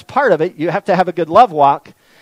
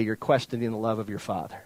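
ordinary delivery

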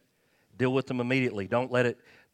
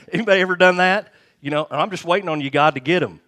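louder, higher, fast and eager; excited outburst; 7 semitones higher, 11 dB louder, faster than usual. Real, not acted. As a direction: fire it at the crowd with a grin. Preacher teasing congregation about revenge.

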